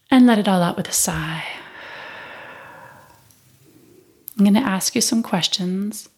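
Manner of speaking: guided meditation. Acted. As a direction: ease the listener into stillness.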